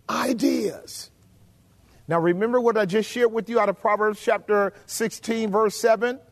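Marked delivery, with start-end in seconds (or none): 0.1-1.1 whisper. Real, not acted. Sudden hushed word in sermon.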